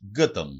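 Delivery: γκέταμ with the l dropped; dialect pronunciation. Shown as a demonstration. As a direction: say γκέταμ as gətam, without any l. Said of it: "Προφέρεται και ως".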